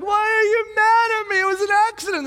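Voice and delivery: put-on high, loud hurt child voice; upset child. Daughter protesting to her dad, high and hurt. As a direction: plead like a wounded kid. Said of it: "she's like".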